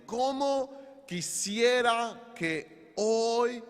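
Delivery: understated longing cry, no sobs; mild tearful lament. Acted. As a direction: lament it slowly, stretching each word. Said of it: "comenzó a llorar, diciendo".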